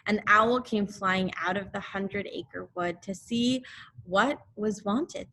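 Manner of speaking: ordinary delivery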